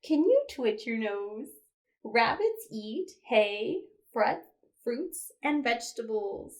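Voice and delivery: normal talking voice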